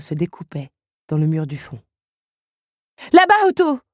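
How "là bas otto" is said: high, loud call across a room; urgent cry. A narrator's reading. Belle points Otto to the French windows, crying out urgently. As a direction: shout to point the way. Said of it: "cria-t-elle en les désignant".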